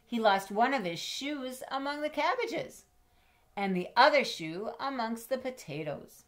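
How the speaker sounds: a dramatic read-aloud; lively chase narration. A narrator's reading. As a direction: tell the chase with breathless urgency.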